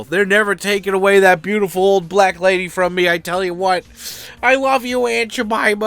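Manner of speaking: swelling into a high, loud mock proclamation; mock-passionate devotion. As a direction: gush with over-the-top, playful adoration. Mock-passionate outburst over syrup brand.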